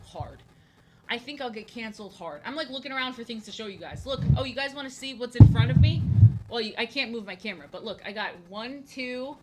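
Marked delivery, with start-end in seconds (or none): 4.1-6.0 excited reveal. Real, not acted.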